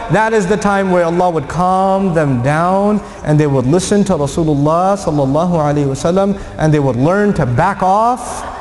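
ordinary talk, nothing marked

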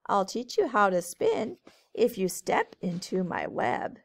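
lilting storybook read-aloud; reading to children. A narrator's reading. Teacher reading a rhyming book to kids, lilting. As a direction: read it brightly to a group of kids.

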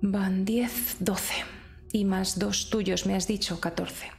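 ordinary talk, nothing marked

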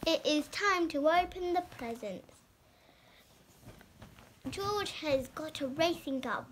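ordinary delivery

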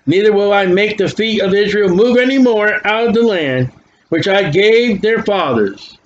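ordinary talk

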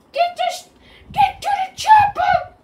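a cartoon squeak of an action-movie line; squeaky voice. Gamer hams it up for his viewers in a squeaky voice. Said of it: "Should I use my squeaky voice?".

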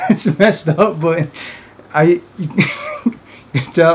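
can barely get it out for laughing; laughing through words. Real, not acted. Telling friends about the embarrassed guy, laughing.

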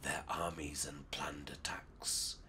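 low, hushed secret whisper. Acted. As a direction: whisper it to a fellow spy. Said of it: "Ras Alula whispered".

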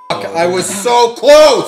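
slow loud frustrated cry; frustrated shout. Real, not acted. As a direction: groan it out loud. Losing the game guess.

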